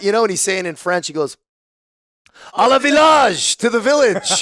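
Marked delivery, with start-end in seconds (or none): 2.5-4.4 rallying cry. Retold or imitated. Imitating the drunk chief announcing a town trip, rallying yell. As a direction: belt it out like a drunken battle cry.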